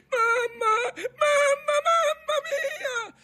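put-on very high, wailing child's scream; terrified shrieking. A narrator's reading. Frightened boy at the tiller in a storm, shrieking. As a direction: shriek for mother, stretching the last cry. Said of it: "cominciò a strillare".

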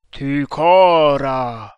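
very slow, loud, drawn-out cry; eerie sustained call; mysterious exclamation. A narrator's reading. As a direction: call the name out long and loud. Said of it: "esclamò la medesima voce misteriosa".

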